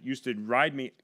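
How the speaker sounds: ordinary talk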